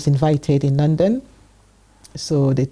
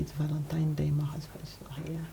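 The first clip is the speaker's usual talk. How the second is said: soft unvoiced whisper to herself; 16 dB quieter than usual. Alone on Valentine's Day, talking to herself: whisper. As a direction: breathe it out, barely audible. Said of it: "I said".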